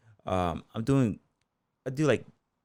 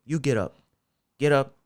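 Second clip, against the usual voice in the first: coach's order, slightly raised and louder; raised voice, imitating a coach picking someone out; retold command; 5 semitones higher, 6 dB louder than usual. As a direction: call out a retold order to a student. Coach ordering students up in story.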